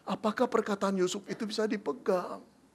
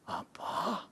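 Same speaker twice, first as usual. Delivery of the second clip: shocked gasp; 9 dB quieter than usual. Acted. Shocked Joseph.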